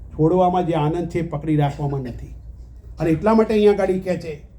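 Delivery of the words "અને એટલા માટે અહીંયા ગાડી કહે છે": ordinary talk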